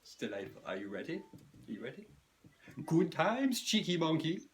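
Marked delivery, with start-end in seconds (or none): 1.2-2.1 softer teasing build-up. Shown as a demonstration. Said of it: "stirring impression of me". 2.9-4.4 higher, loud hammed-up cheer; self impression. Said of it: "stirring impression of me".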